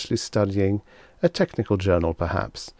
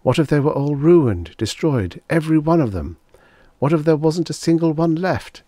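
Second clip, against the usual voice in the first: panicked thoughts; 7 semitones higher, 5 dB louder than usual. A narrator's reading. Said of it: "A sudden panic gripped Henry Bemis".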